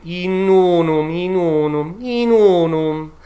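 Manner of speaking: Italian diction; Italian unum. Shown as a demonstration. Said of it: "where in Italian".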